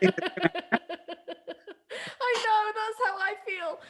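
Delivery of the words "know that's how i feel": high, laughing through the words. Real, not acted. Co-host laughing along about the episode, laughing. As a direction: break into giggles as you agree.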